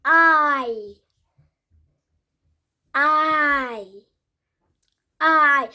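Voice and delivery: "I" said as a full diphthong, loud; model pronunciation. Shown as a demonstration. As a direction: demonstrate the sound "I" slowly. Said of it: "we're going to be starting with".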